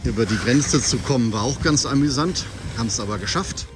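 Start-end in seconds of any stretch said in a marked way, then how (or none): none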